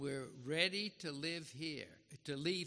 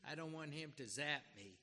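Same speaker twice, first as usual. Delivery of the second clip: normal talking voice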